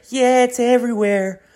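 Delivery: very high imitated little-girl voice. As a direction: put on a tiny kid's high voice. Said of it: "the little girl's just like".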